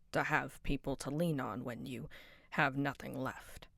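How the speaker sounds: low, soft, slow consoling voice; gentle comfort. Acted. Kind character consoling Vaughn about loss and loneliness, soft and tender. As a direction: talk them down tenderly.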